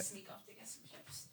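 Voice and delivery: breathy whisper, sneaking a snack. Real, not acted. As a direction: whisper it, off mic. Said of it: "you supposed to whisper".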